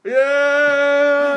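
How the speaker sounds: long, high, loud whoop; drawn-out hype shout; party holler. Pretend. Hyped party call after outro.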